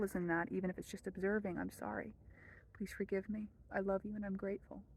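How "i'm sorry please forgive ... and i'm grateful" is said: soft, hushed prayer recitation; quiet prayer. Shown as a demonstration. Teacher reciting the prayer for viewers, hushed and soft. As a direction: murmur it like a private prayer.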